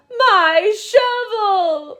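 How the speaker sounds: loud, slow crying wail; weeping. Acted. Peter weeps for his shovel, loud slow crying wail. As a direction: sob it out long and loud. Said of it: "Peter wept".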